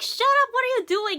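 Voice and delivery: a high yelled shut up; rude shout. Retold or imitated. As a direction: bark it at him like a spoiled brat. Voicing a rude king's retort.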